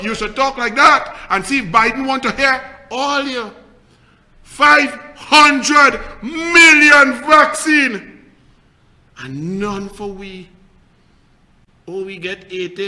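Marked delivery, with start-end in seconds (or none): 4.4-8.2 very slow, high shout; loud outraged emphasis. Real, not acted. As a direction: shout each word, stretched right out. Outraged at the number of vaccines.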